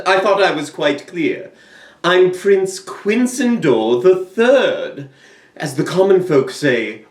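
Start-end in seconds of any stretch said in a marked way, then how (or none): 2.1-5.1 slow, grand self-introduction; slow proclamation. Acted. Prince restating his name to the viewers, slow and grand. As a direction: announce every syllable.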